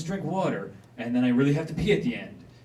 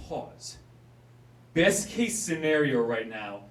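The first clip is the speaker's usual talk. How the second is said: normal talking voice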